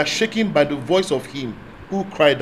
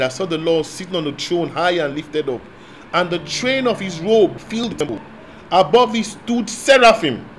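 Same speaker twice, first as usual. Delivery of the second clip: normal talking voice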